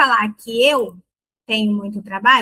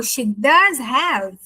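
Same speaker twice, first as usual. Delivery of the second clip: "does" stretched and stressed in "She does have"; emphatic does; much slower than usual. Shown as a demonstration. Teacher showing does turns into emphasis, stressed does. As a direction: lean slowly on "does". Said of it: "na verdade eu tô enfatizando".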